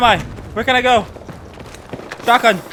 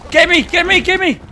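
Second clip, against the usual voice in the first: shouting for help; 10 semitones higher than usual. Real, not acted. Panicked calls for rescue in game.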